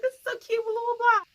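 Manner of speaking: very high-pitched cooing imitation; mock gushing. Friend greeting woman at lunch, mock gushing.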